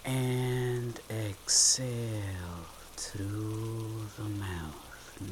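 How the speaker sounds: very slow, soft, drawn-out words fading away; hushed, lingering breathing cue, sinking at the end; slow guided exhale. Shown as a demonstration. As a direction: stretch each word to pace a long breath out. Guides the actual exhale on count.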